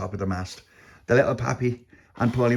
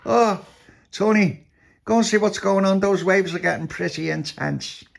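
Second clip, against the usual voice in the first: alarmed captain; 10 semitones higher than usual. A narrator's reading. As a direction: call out the order, high and urgent. Captain orders Tony in worsening waves.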